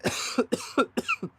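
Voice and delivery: real choking cough after a sip; coughing up water. Real, not acted. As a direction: hack out water gone down the wrong pipe. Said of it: "that water went down the wrong pipe".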